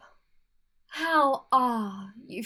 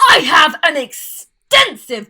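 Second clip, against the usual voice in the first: indignant; 15 dB louder than usual. Acted. Famine defends herself indignantly.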